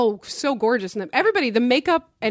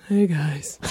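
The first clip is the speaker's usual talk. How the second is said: lower, dopey pet voice; cat voice; 10 semitones lower than usual. Retold or imitated. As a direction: greet them like a dumb cat. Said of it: "she's got a, like".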